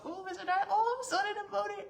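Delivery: very high, gushing squeal; hammed-up lovestruck falsetto; mock swooning. Swooning as Darcy walks the field, mock devoted squeal.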